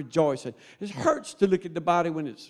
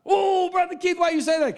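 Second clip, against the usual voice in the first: high-pitched whining objection; mock protest; 12 semitones higher, 4 dB louder than usual. Preacher mimics a member objecting to his words, mock protest. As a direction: pipe up high in mock dismay.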